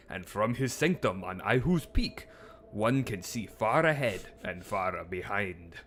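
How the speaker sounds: a hint of a mock Korean accent, solemn wise sage. Playing a wise sage for chat, lightly put-on Korean accent. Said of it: "continues the accent bit".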